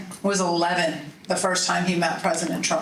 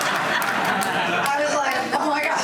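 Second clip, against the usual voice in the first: mortified laughter; 4 semitones higher, slower than usual. Retold or imitated. Son asks president for selfie.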